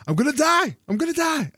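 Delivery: high mock-panicked cry; freaking out. Downed player watching his timer go red, freaking out. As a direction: squeal it in comic panic. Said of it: "I'm beginning to freak out".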